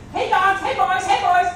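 high, loud call for the dogs. A narrator's reading. Lonely old man calling loudly for his missing dogs. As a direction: holler for the hounds in a panic. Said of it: "the old man thought".

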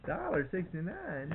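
slow, high incredulous echo; surprised. Real, not acted. Startled echo of a quoted price.

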